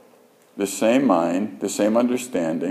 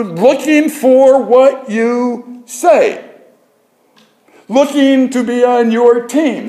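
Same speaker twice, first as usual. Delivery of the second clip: high, drawn-out pulpit cry; impassioned preaching; 13 semitones higher, 5 dB louder, slower than usual. Real, not acted. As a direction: preach it slowly at full stretch, high and emphatic. Preacher returns to forceful preaching.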